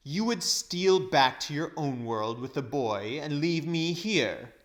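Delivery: loud, scornful accusation; accusing witch. A narrator's reading. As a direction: throw the charge at him. Witch accuses Uncle Andrew.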